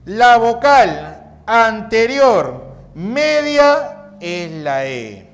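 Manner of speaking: slow, loud dictation to students; slow reading aloud. Shown as a demonstration. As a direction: read it out deliberately for the class to copy. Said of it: "Leemos así".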